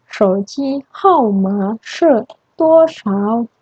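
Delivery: very slow, careful Mandarin question; slow model question. Shown as a demonstration. Teacher modelling a question for students, very slow. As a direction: stretch every syllable for learners. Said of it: "contoh kedua".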